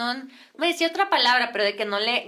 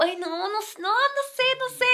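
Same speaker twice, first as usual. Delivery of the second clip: helpless fretting; 9 semitones higher than usual. Retold or imitated. Acted helpless reply.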